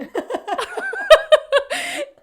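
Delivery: high, loud laugh through tears; tearful laugh. Real, not acted. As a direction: burst out laughing, emotional. Emotional reveal moment.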